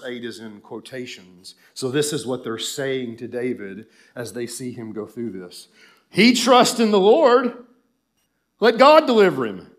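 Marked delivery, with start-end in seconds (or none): 6.1-9.7 loud, high, jeering recitation; scornful mockery thrown out in bursts; mocking. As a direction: taunt the sufferer from the crowd. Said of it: "it's a mockery".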